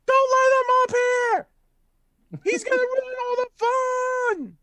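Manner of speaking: put-on very high, loud squealing child voice; kids screaming. Kids scream at the dwarf climbing their tree, high squeal. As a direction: shriek it like a panicked little kid. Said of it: "and then they scream".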